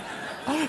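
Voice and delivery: a sharp high gasp of alarm; mock gasp. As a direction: gasp like you just realized. Wife realizes child left behind.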